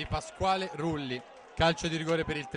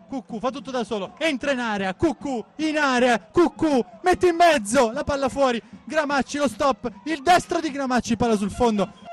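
high, rising excited call; excited commentary; 8 semitones higher, 4 dB louder than usual. Real, not acted. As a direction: build with the attack. Attacking run to shot.

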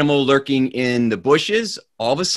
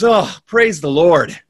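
relieved exclamation; 5 semitones higher, 5 dB louder than usual. Acted. Horse stops at cliff edge.

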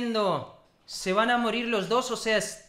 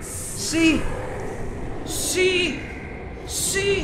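high yeses building to a huge scream; mounting elation; 6 semitones higher, much slower than usual. Real, not acted. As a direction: climb higher each time, then explode. Hero finally arrives. (euforia creciente hasta el grito)

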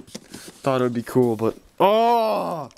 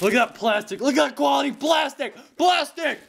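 rising higher into a shout; mock-excited hype; 11 semitones higher than usual. Real, not acted. Fan hyping the packaging, building to a shout. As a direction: hype up the plastic.

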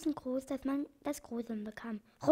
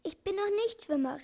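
high, anxious call for help; frightened cry; 6 semitones higher than usual. Acted. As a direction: cry out high that you cannot swim. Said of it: "schrie T-, Tschitschi". (hoher, ängstlicher Ausruf)

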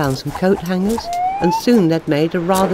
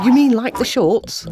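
bright; 7 semitones higher, 4 dB louder than usual. A narrator's reading. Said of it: "said Malcolm brightly".

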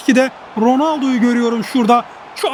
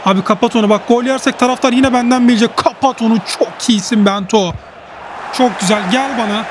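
ordinary talk, nothing marked

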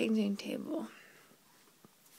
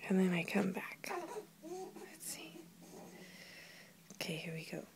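much softer, hushed whisper; 6 semitones lower, 14 dB quieter than usual. Real, not acted. Mother whispering before popping back to the baby. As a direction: hush it, about to surprise. Peekaboo game, sneaking back.

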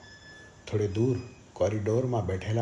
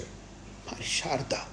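slow, higher, soft whispered wail; grief-stricken; 5 semitones higher, 5 dB quieter, much slower than usual. A narrator's reading. Husband mourns his dead wife, grief-stricken. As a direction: voice thins to a drawn-out whisper.

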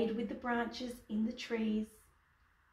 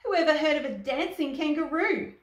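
high, loud acted disbelief; storybook outcry of amazement; incredulous cry; 5 semitones higher, 7 dB louder, faster than usual. A narrator's reading. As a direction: exclaim like a scandalised crowd. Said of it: "everyone cried".

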